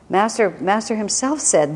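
normal talking voice

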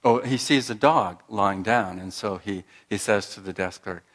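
usual speaking voice

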